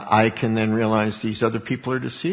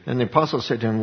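ordinary talk, nothing marked

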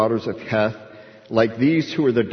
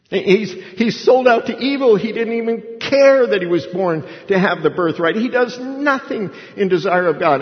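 loud, high, indignant wife; exasperated Rebekah; 9 semitones higher, 5 dB louder than usual. Acted. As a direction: hammer it home to a husband, acted out. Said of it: "Rebekah said".

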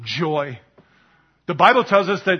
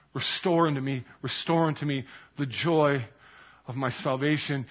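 softer, a quiet plea to God; soft pleading; 4 semitones lower, 8 dB quieter than usual. Acted. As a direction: pray it low and humbly, as a repentant man. David's broken plea, said softly.

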